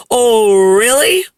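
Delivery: loud drawn-out "oh", rising sharply; mock amazement, swooping up on "really"; sarcastic retort. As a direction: challenge him with a sarcastic swoop. Scornful retort to imagined dad.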